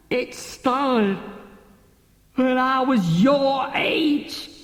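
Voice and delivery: put-on slow, booming drunken declaration; drunken outburst. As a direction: roar it out as the bottle wins. Captain bursts out, laughing drunk.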